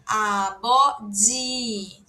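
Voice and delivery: "A, bó, di" separated, with voiced B and DJ; syllable-by-syllable demonstration. Shown as a demonstration. Teacher models the Korean word for father, slow syllabic demonstration. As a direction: stretch out "A, bó, di", one syllable at a time. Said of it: "D J I".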